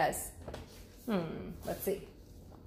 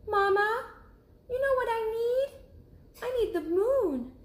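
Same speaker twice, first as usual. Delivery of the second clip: high, small cub voice; little cub asking; 3 dB louder than usual. Acted. Cub asking mother about sleeping outside, high little voice. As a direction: ask like a curious little bear cub. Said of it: "bumped Mother Bear and said".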